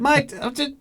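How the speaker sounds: very high, laughing cartoon voice; cartoon twig. Cartoon twig pleading under the runner's foot, high squeak. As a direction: squeak it out through a laugh, loudly. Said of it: "twig with the cartoon face going".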